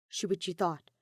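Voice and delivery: ordinary delivery, nothing marked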